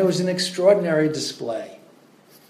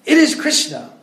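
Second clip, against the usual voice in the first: "It is Krishna" even higher and louder, hammering is; climactic shout; 11 semitones higher, 6 dB louder than usual. Retold or imitated. Prabhupada's last word to the student, forceful shout. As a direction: top it off, the final blow. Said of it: "speak with force".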